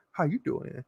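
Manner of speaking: imitating a low, quiet pickup line; low, soft come-on. Imitating the Walmart stranger's quiet come-on to her. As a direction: mimic the stranger's soft, leering greeting. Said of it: "it was kinda like that".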